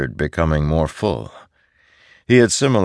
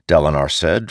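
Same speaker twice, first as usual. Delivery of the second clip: usual speaking voice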